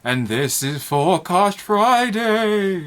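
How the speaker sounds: slow, drawn-out spooky ghost voice. Pretend. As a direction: moan it out like a haunting spirit. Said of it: "I'm doing a ghost voice".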